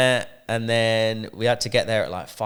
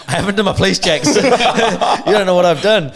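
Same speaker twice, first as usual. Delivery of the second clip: laughing; 9 semitones higher, 10 dB louder than usual. Retold or imitated. Retold panic, laughing through it.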